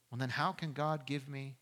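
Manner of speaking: ordinary talk, nothing marked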